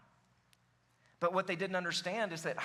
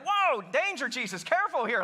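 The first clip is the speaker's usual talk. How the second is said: higher, louder startled warning; acted-out startled caution; mock alarm; 4 semitones higher, 4 dB louder than usual. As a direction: blurt out a playful warning. Acting a startled first-time reader.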